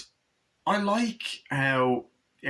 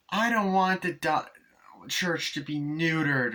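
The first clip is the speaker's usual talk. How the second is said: put-on slow, higher childlike plea; little girl voice; 7 semitones higher, slower than usual. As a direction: plead like a worried little girl. Voicing daughter about cat.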